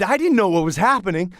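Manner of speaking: ordinary delivery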